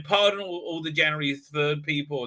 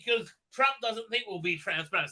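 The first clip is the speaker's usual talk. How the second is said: ordinary delivery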